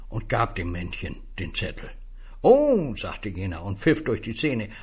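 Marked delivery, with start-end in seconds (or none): 2.4-3.0 high, loud whistle-like oh; a bright astonished oh; high impressed oh. Acted. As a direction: sound pleasantly surprised. Stranger impressed.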